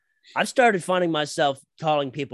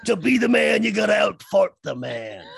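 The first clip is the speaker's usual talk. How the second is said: loud, high wrestling promo shout; 5 semitones higher, 6 dB louder than usual. Acted. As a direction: yell it like a pro wrestler hyping a match. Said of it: "put on your best wrestling voice".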